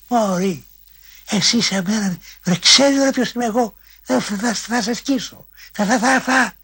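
hammed-up furious yelling at a fly; imitating a howl. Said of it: "ακούω τον Μακρύ να ουρλιάζει".

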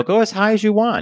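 high, loud call up a tree; loud, carefree shout of permission, re-enacted; retold calling up. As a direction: imitate calling up to a climbing child. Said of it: "I go".

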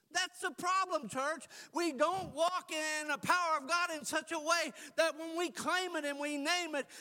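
loud, high, driving sermon voice; preaching at full force. Real, not acted. Preacher rousing the church, loud and high.

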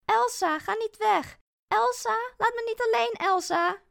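high, loud pleading call after her; desperate calling. Acted. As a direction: beg her loudly not to leave you alone. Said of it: "roep ik haar na". (hoog, luid en smekend roepen)

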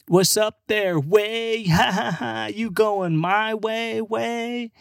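high, drawn-out mock-bro voice with a laugh; overfamiliar American bro. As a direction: ham up a clueless drunk trying out slang. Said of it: "was it the accent?".

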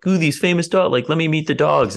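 high, gushing imitation of a dog fan; mock eager fan. Mocking fans who only want to meet his dogs, high and gushing. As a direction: gush like someone who only wants the dogs.

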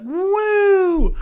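loud, high "woo!"; long high whoop; excited cheer. Shown as a demonstration. Cheering example of making noise.

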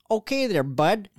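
high mock Canadian accent. Shown as a demonstration. Friend offering another sample, mock Canadian accent. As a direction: lift it high, folksy Canadian. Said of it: "you say the Canadian accent".

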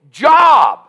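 high shouted peak on one word; shouted climax. Real, not acted. Preacher to welfare fathers, a high shouted peak.

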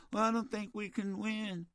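soft mock quitter voice; hushed mock of giving up; mocking the caller. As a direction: parrot the caller's defeatism back quietly. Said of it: "That's what he just said".

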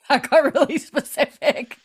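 real laugh running through the words, pitched high; amused laugh while saying it. Real, not acted. Laughing at cohost's crude joke.

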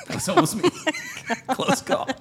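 high giggling through words; laughing. Real, not acted. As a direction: laugh while saying it. Laughing at his escape.

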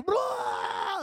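high, drawn-out mock puke wail; imitated retching. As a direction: fake a long high-pitched vomit noise. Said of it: "Like, puking his brains out".

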